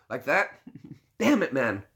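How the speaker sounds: normal talking voice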